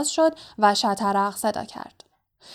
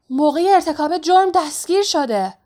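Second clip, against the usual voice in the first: high-pitched, raised-voice accusation; accusing shout; 7 semitones higher, 4 dB louder than usual. A narrator's reading. Breathless student points at Harry at the crime scene, accusing cry. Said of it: "با انگشت هری را نشان داد و فریاد زد".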